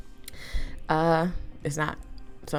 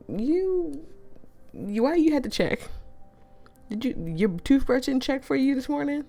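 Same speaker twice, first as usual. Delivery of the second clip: high, loud, sassy accusation; mock the judging friend, sassy; sassy girl voice; 8 semitones higher, 3 dB louder than usual. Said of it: "they're gonna be like".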